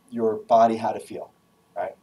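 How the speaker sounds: usual speaking voice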